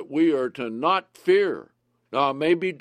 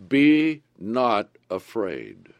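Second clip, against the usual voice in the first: each word slow and weighty; solemn slow scripture line; slow emphasis; 5 semitones lower, 3 dB louder, much slower than usual. Acted. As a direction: lay it down slowly, word by word. Preacher stresses fear not.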